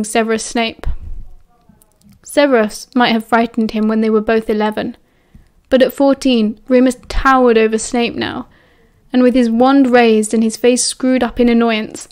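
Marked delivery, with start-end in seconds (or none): none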